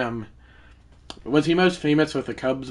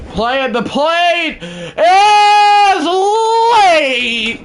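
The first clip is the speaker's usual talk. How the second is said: slow, much higher and louder play call; announcer call; 9 dB louder, much slower than usual. Acted. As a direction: stretch it like a broadcaster at the plate. Announces a close play at home.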